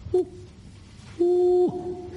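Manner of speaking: high hooting woo, like it comes from the distance; high falsetto echo call; far-off answering call. Retold or imitated. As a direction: hoot as the faraway bunyip replying. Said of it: "And I heard far off".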